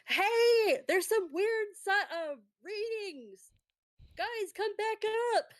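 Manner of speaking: high, stretched-out holler to friends; yelling. Acted. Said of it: "James is already at the edge of ... the crater and yelling".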